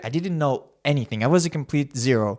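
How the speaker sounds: usual speaking voice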